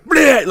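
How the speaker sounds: loud high burst of mock monster noise; sharp, high shouted imitation of a jump scare; jump-scare "blah". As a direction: blurt a sudden scare noise.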